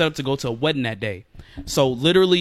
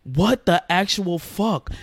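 retold disbelief; 3 semitones higher, much slower than usual. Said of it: "She was like".

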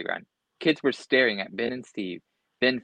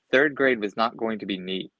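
ordinary delivery, nothing marked

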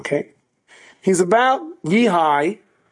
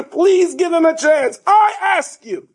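impassioned plea; 13 semitones higher, slower than usual. Retold or imitated. Boy begging the principal for his friend, impassioned and slow. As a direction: beg as if on hands and knees. Chaim's plea retold at sermon climax.

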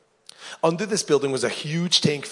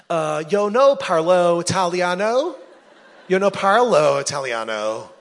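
mock Italian; 5 semitones higher, 7 dB louder, slower than usual. Captive claims no Italian.

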